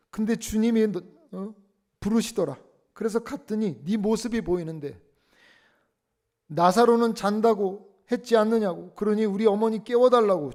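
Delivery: high, loud, angry scolding; scolding mother. Retold or imitated. Revived mother scolding her son for calling her back, loud. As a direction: shout down at the crying son. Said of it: "뭐라고 그러셨는가 하니까".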